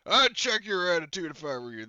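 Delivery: forceful in-character reprimand; drill sergeant. Acted. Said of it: "Drill Sergeant Orgus says".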